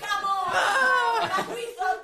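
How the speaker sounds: loud, high, laughing cheer; excited laughing shout. Real, not acted. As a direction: cheer through laughter at the win. Celebrating the correct guess.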